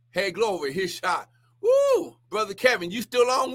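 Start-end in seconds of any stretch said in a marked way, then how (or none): 1.6-2.2 high whooping cry of praise; ecstatic woo. Real, not acted. Preacher whooping in praise to his livestream, high and ecstatic. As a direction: let out a joyful whoop.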